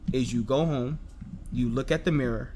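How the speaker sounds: ordinary delivery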